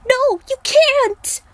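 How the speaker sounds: very high, loud, slow anguished plea; high, drawn-out girlish wail of protest; desperate cry. Acted. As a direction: cry out to stop him leaving. Aiko begs Liu not to go.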